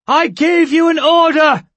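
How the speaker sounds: high, loud indignant shout. A narrator's reading. Two shouting at the guard captain. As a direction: scream the order. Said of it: "she shouted".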